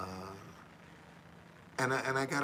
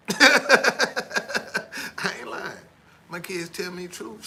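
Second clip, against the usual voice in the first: real laugh breaking through the words; tickled by his own story; 8 semitones higher, 13 dB louder, much slower than usual. Real, not acted. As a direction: laugh it out, high and loud. Laughs at own claim about kids.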